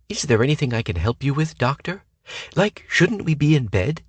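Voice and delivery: 1 breathy flirt. A narrator's reading. Flirty woman offers help.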